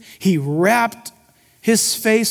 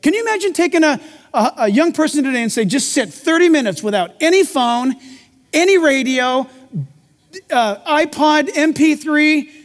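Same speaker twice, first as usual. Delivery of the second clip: preaching at full force; 6 semitones higher than usual. Acted. Pastor urging his congregation, preaching hard. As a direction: build the sermon up with urgency. Preaching about youth and silence.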